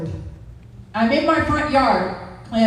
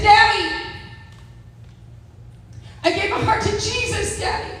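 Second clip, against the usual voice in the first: tender call to father; 7 semitones higher than usual. Retold or imitated. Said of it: "I said".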